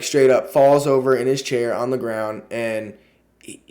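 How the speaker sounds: usual speaking voice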